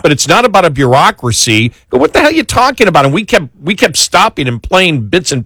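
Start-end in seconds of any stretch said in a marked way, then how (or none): 1.9-3.0 high, fast incredulous challenge; exasperated retort. Retold or imitated. Radio host replays his reaction to her speech, exasperated. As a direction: fire it back in disbelief.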